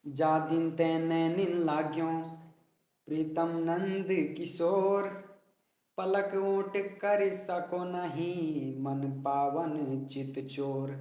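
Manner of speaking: slow, drawn-out verse phrasing; rhythmic verse recitation. Shown as a demonstration. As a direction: slowly stretch Kishore and चोर, pausing after लाग्यो. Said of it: "देखिए इसको कैसे पढ़ना है". (धीमा लयबद्ध छंद पाठ)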